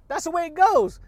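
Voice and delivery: excited catchphrase. Real, not acted. Hyping his listeners with an excited exclamation. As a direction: sing it out with gusto.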